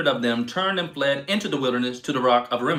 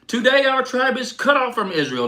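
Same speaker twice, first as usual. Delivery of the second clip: much higher, louder lament; grieving outcry; 10 semitones higher, 5 dB louder than usual. A narrator's reading. Grieving Israelites lament Benjamin's loss, higher and louder. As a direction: cry out the loss of a tribe. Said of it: "the Israelites grieved".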